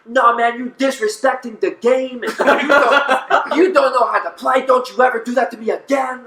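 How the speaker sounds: high-pitched imitation of an irate opponent; retold angry yelling. Opponent yells at Dallas in front of everybody, mock-furious. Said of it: "he starts yelling at me".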